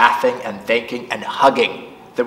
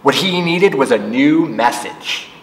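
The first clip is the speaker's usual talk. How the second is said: ordinary delivery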